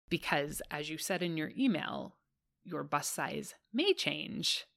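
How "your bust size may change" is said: "may" stressed and drawn out, line slowed. Real, not acted. Host answering a listener's fit question, stressing "may". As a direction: lean on the word "may". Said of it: "Emphasis on the word may".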